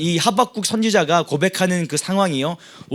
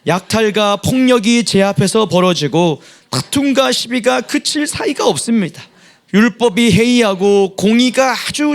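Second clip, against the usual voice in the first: loud, higher group recitation; scripture reading; 4 semitones higher, 4 dB louder than usual. Acted. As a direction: read the lament out boldly. Reads scripture with congregation. (힘 있게 함께 성경 낭독)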